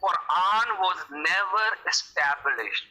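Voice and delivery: very slow, deliberate quoting; emphatic slow quote. Retold or imitated. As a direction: spell out the quote slowly, word by word. Host re-quotes clip slowly for emphasis. (धीरे-धीरे, ज़ोर देकर उद्धरण)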